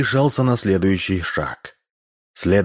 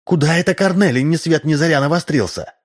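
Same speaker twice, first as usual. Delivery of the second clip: nagging wife; 8 semitones higher than usual. A narrator's reading. Wife grumbling from inside the house.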